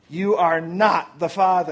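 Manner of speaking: slow, drawn-out talk-show reveal; dramatic verdict. Acted. Host delivering the paternity test result, dramatic verdict. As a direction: announce the result slowly, spacing the words.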